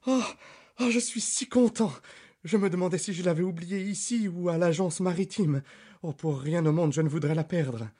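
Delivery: high, excited and delighted; a jubilant cry of relief at finding it; overjoyed doctor finding his lost cane; cry of joy. Acted. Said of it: "il poussa un cri de joie".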